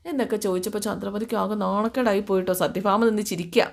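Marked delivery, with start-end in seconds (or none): none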